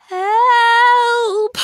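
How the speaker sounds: high, loud scream for help; yelling. A narrator's reading. Kipper terrified of the real monster, yelling. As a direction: shriek for help in real fright. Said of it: "yelled Kipper".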